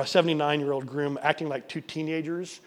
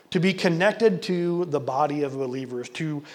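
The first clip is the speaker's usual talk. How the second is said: louder, slowing preacher's push; impassioned preaching; 4 semitones higher, 7 dB louder, slower than usual. Real, not acted. Preacher calls wandering members back, impassioned. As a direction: urge the flock back.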